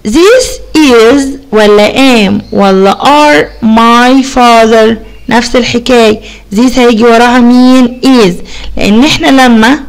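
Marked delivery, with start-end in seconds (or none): 0.1-4.9 slow, drawn out choices; slow reading of options. Shown as a demonstration. Teacher reading the choices slowly to pupils. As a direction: read each option slowly. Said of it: "reading the options".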